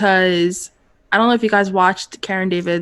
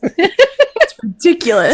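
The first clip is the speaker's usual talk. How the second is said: laughing; 9 semitones higher, 5 dB louder, much slower than usual. Real, not acted. Laughing about shipping.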